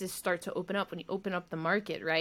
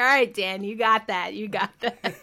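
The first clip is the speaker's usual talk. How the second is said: louder, teasing surrender; mild mock defeat; grudging concession; 8 dB louder than usual. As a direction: give in with a laugh. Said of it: "I was like".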